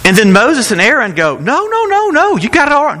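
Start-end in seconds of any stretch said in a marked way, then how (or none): 1.4-3.0 high, light, sarcastic no-no-no; playful sarcastic denial; mock reassurance. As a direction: brush it off in a silly high voice. Mock reassurance, then laughs.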